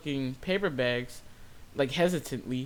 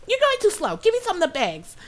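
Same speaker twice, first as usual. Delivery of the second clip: loud, very high mimicked yell; mock a fed-up customer, shrieked high and loud; impatient Karen outburst; 7 dB louder than usual. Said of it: "She's like".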